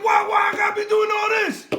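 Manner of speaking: high, loud exasperated whine; high frustrated yell; frustrated Daniel. Retold or imitated. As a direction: complain like a fed-up kid. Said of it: "he said".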